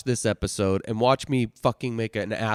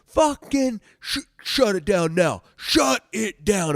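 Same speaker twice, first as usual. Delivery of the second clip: barked order; 7 semitones higher, 5 dB louder, slower than usual. Retold or imitated. Instructor orders recruits to stop.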